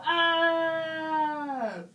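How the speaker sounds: held "aah" of a pretend yell, falling at the end; imitated scream. Shown as a demonstration. Teacher imitates the shouting man in her picture, mock scream. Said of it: "un señor gritando".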